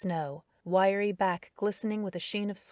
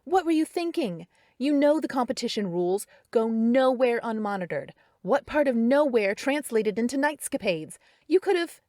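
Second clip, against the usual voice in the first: much higher and louder, shouting; angry rant; 6 semitones higher, 8 dB louder than usual. A narrator's reading. Carrie yelling competition rules at Darren, angry rant. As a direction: tear into him, rising fury.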